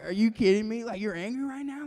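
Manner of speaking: high, loud disbelief; incredulous. Acted. Reacting to the angry brother, incredulous.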